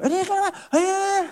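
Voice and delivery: wife's panicky voice. Retold or imitated. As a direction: blurt it like a panicked wife. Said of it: "Misal, ... kayak gitu-gitulah ya".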